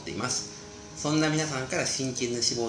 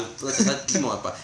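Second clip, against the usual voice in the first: normal talking voice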